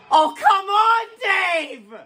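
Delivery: usual speaking voice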